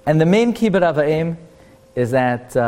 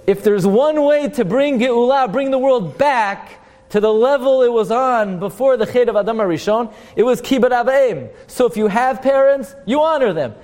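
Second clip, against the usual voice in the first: fervent preaching; 8 semitones higher than usual. Real, not acted. Preacher building to the point.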